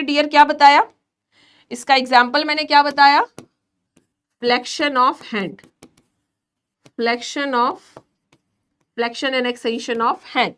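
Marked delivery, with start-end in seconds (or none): 4.4-10.5 drawn out word by word for notes; slow dictation. Shown as a demonstration. Teacher dictates the flexion example for students' notes, very slow. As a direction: dictate it slowly, pausing between words.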